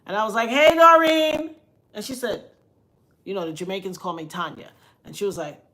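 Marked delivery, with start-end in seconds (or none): 0.5-1.6 loud, high, drawn-out greeting; calling out. Retold or imitated. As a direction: sing out a hello for someone on the phone to hear. Calls out to woman on phone.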